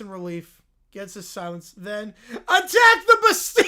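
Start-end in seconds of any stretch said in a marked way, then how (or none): 2.3-3.6 a huge laughing rally cry; battle cry. Acted. Prisoner rallying the revolution with a shout. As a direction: storm the prison, full volume.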